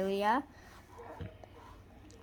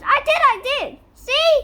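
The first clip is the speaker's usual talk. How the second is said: high, loud eager insistence; loud high protest; eager insisting; 11 dB louder than usual. A narrator's reading. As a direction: insist brightly.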